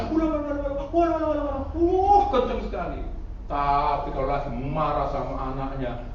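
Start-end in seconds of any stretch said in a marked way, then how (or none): 0.1-2.2 a light parody of tongues, quick babble; mock speaking in tongues. Preacher parodies churchgoers' tongues, light mock babble. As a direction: babble ulah ulah as if in the spirit. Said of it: "bahasa rohnya".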